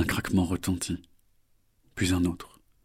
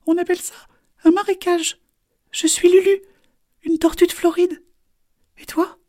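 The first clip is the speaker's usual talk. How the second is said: very high, squeaky little turtle; tiny high turtle voice; 8 dB louder than usual. Acted. Turtle Lulu introduces herself.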